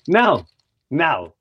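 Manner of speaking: normal talking voice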